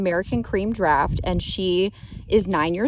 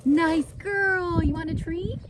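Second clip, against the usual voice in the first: talking to a horse; 8 semitones higher than usual. Real, not acted. Praising the horse.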